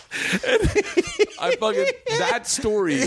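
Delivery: talking through high laughter; laughing hard. Real, not acted. Comic recalling a school story, laughing through the words. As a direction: crack up while trying to speak.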